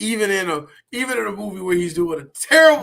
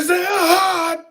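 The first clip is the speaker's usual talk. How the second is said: mock whiny falsetto; 6 dB louder, much slower than usual. Mocking high crying voice.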